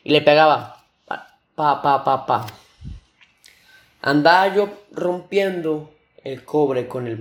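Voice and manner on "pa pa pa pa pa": rhythmic spoken "pa" hammer taps; imitated hammer blows. Storyteller shows himself hammering the chisel, percussive imitation. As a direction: knock out a run of hammer hits by voice.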